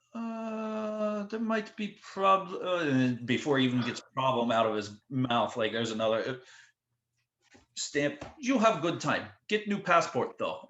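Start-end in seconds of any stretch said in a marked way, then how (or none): 0.1-2.8 high, slow, hesitant mock Russian officer. Customs agent to the band, stalling in a high hesitant voice. 8.4-10.7 mock Russian officer. Customs agent to the traveller, cheery Russian-accented farewell. Agent waving them through.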